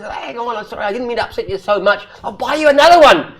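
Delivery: rising, fast, then high and loud apology; a big burly trucker backing off in a hurry; trucker apologizing. Acted. Joke teller voicing the sorry trucker, mock apology. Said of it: "a trucker, he says".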